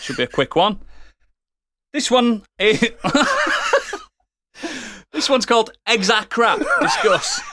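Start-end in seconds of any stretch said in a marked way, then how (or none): 1.9-7.4 laughing. Real, not acted. Host reading title through laughter.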